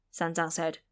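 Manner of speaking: ordinary delivery, nothing marked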